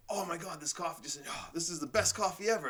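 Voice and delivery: mock rapture over coffee; coffee delight. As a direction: sell it as the best coffee ever. Acting out loving his coffee.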